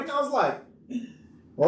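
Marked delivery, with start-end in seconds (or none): 1.6-1.7 cartoon confusion. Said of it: "Scooby-Doo moment".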